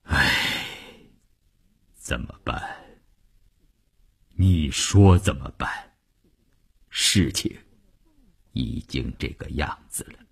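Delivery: low, subdued resignation after a long sigh; heavy sighing. Acted. Husband answers his distraught wife after long silence, heavy and resigned. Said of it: "长长的出了一口粗气".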